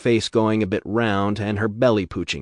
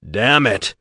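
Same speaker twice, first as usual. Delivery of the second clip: grunt; 2 semitones higher, 6 dB louder than usual. Acted. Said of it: "Ryan grunted".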